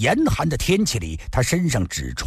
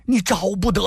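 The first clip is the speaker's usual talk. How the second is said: higher, sudden cry of alarm; alarmed outcry; 6 semitones higher than usual. A narrator's reading. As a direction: blurt it out, startled for his brother. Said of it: "失声地说道". (失声惊呼)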